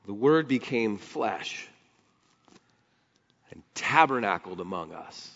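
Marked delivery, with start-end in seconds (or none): none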